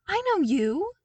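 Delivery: put-on eager, bright recognition of an old name; excited. A narrator's reading. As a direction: blurt it out in delighted recognition. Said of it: "he told the man excitedly".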